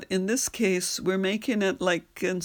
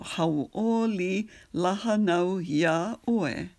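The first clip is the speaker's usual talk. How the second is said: spoken, unsung, syllable by syllable; slow spoken phrase; slower than usual. Shown as a demonstration. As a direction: say it slowly for practice. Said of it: "just practice that".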